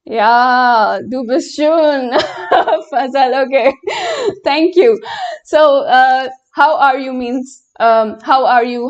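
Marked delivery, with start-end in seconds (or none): 2.2-2.8 a quick bashful giggle; flustered little laugh at the compliment; shy laugh. Real, not acted. Flattered by a viewer's compliment. 3.8-4.4 a sudden high-pitched giggle; bashful laugh shooting up high; high squeaky laugh. Real, not acted. Flattered by a viewer's compliment.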